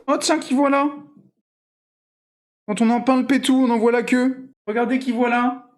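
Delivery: high, affectionate sing-song; cooing pet talk. Real, not acted. Papa greeting a beloved pet after its nap, cooing. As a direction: coo in a high doting voice. Coos to a pet just woken.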